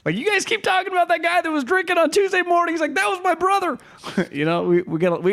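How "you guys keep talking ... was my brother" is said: high put-on fan voice quoting a message. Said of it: "someone D M me, like".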